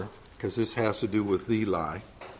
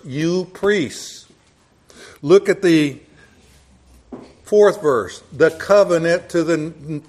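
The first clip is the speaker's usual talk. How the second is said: louder, insistent pulpit preaching; 5 semitones higher, 6 dB louder than usual. Acted. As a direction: drive the point home from the pulpit. Preaching at full force.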